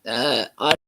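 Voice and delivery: usual speaking voice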